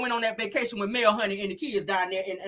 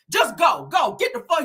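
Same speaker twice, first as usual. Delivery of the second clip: high, forceful order; mock-mad command; retold angry dismissal; 8 semitones higher, 5 dB louder than usual. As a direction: send someone packing, voice raised. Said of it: "he's still low-key mad".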